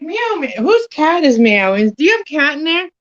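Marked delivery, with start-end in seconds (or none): 0.0-0.5 high little meow meow; copying the cat he heard; imitated cat meow. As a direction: mimic a cat's meow, pitched up. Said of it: "I heard, like".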